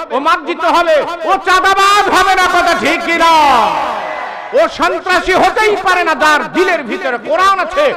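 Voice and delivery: high, loud, full-force waz preaching; preacher driving his point home to the gathering, fiery. Acted. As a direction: thunder it at the crowd.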